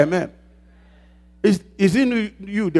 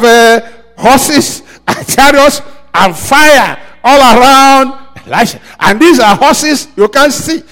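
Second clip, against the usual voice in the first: loud, higher preaching with laughs; fervent preaching; 6 semitones higher, 6 dB louder than usual. Acted. Pastor describing Elisha's unseen horses, fervent loud preaching. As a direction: paint the scene with rising fire.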